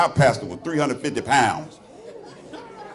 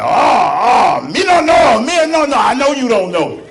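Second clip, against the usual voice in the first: high, loud mock accent; hammed-up broken English, much higher; mock Korean owner; 9 semitones higher, 6 dB louder than usual. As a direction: squeal it like a flustered restaurant owner. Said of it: "He's like".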